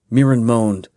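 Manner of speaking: usual speaking voice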